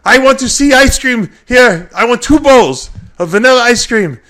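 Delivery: high, loud mock Nasser tantrum; impatient accented bodybuilder yelling; Nasser's outburst. Imitating Nasser scolding the slow restaurant staff, high and loud. Nasser demands ice cream.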